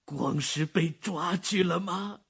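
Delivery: high, slow, dreading question; 担忧的老人; fearful old man. Acted. Father fears worse.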